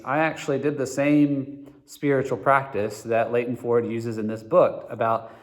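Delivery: normal talking voice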